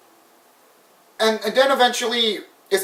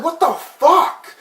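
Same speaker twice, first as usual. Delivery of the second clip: outraged disbelief; 10 semitones higher, much slower than usual. Retold or imitated. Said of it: "I was like".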